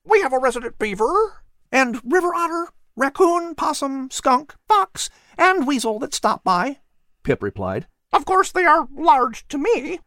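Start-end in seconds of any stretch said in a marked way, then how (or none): 0.0-6.8 high, chirpy bird voice; a tiny bird listing its visitors; little bird Pip. Acted. Bird Pip answering the cat in a high chirpy voice. 8.1-10.0 high, chirpy bird voice; a tiny bird listing its visitors; little bird Pip. Acted. Bird Pip answering the cat in a high chirpy voice.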